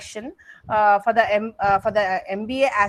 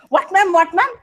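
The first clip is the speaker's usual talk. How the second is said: high, loud startled what, ma'am; echoing a startled student; surprised what; 7 semitones higher, 6 dB louder than usual. Shown as a demonstration. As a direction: sound caught off guard. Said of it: "You see the emotions that came into Mac's voice".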